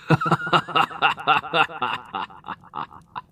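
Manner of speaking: a high, gloating villain laugh; evil laugh. Acted. Demon King laughs at the startled hero, gloating. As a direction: cackle at the hero's recognition.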